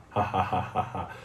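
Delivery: gloating enemy. Acted. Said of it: "your enemy is so happy".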